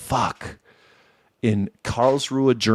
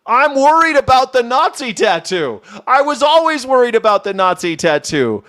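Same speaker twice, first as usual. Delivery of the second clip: mock worry; 11 semitones higher, 4 dB louder than usual. Mock panic, high and loud.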